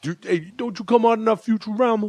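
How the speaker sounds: high-pitched pestering fan; put-on high fan voice; excited fan character. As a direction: play a fan who has spotted someone famous. Acting a fan recognizing him.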